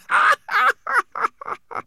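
loud, high gloating laugh; high mocking laugh. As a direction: laugh him down. Rosenberg laughs again.

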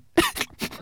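short breathy sob; sobbing. A narrator's reading. Homunculus afraid of losing his master, sobbing. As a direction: catch your breath in a sob. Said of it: "the homunculus sobbed even louder".

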